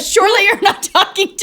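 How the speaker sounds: high, loud laughing disbelief. Retold or imitated. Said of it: "and I said".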